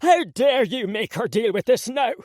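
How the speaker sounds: furious father. Acted. Father blaming son furiously.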